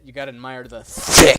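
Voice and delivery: shouting. Real, not acted. Bursting out loudly while joking.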